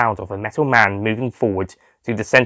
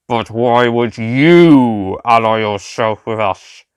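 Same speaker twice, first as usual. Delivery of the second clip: very slow, flat robotic drone; robotic Cyberman voice; 4 dB louder, much slower than usual. A narrator's reading. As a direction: speak like a cold machine, word by word. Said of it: "the cyber leader asked".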